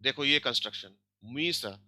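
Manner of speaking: ordinary delivery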